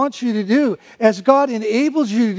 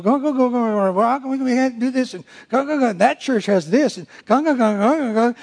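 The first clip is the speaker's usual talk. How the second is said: mock grumbling. Mocking church complainers.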